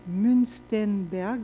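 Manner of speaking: "Münstenberg" said very slowly with a careful, shaky German accent; attempted German accent. Shown as a demonstration. Lecturer naming a German psychologist, slow, awkward German accent. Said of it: "mon accent allemand n'est pas très bon".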